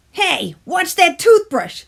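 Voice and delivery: high, loud, slowed yell; shouting tooth. A narrator's reading. Tooth shouts at the toothbrush, high and loud. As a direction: bark a warning. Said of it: "shouted the Tooth".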